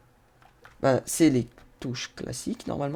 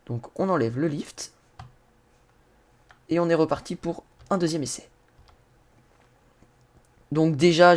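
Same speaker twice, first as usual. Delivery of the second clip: usual speaking voice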